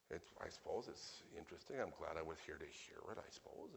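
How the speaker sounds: soft, half-hearted mock approval; lukewarm listener. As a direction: imitate grudging interest, dropping the volume. Voicing a lukewarm listener's response.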